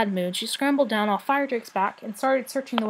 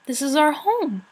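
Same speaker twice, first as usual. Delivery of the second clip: despairing plea; 5 semitones higher, 5 dB louder than usual. A narrator's reading. Dragon pleading in despair.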